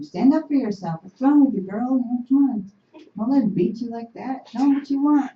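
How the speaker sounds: rally her to stand up, forcefully; urging. Retold or imitated. Haudenosaunee women rousing a beaten woman, loud urging. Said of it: "We're the one that told them".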